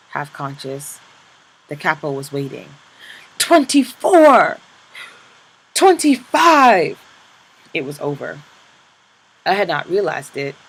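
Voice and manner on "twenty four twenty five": slow, loud, high shouted count; drawn-out count shout. Acted. Capo shouting the lash count, slow and loud. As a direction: stretch the number out as a shout.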